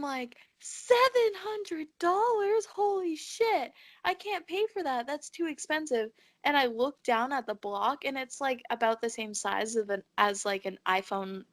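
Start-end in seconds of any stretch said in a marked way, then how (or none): 0.6-6.1 mock outrage at a seven hundred dollar price; shocked. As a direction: squeal it out like the number hurts.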